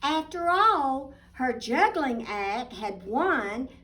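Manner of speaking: put-on lively storybook narration for little girls; reading to children. A narrator's reading. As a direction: read it like a bedtime picture book. Picture book read aloud.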